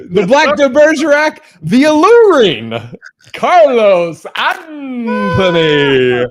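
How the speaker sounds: high, booming, stretched-out introduction; hype intro announcer. Pretend. Host belting out his co-host's forgotten intro, big announcer voice. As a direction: announce him like a ring announcer, dragging out the name. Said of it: "forgot to say in your intro".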